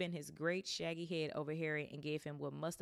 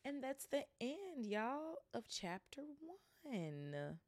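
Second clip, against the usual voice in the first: playful sing-song; 10 semitones higher, slower than usual. Real, not acted. Playful sign-off after chapter.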